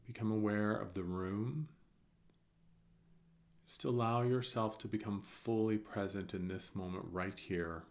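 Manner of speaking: soft, calm meditation voice with long pauses; guided meditation. Real, not acted. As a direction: guide them gently through each breath, softly. Leads a breathing meditation.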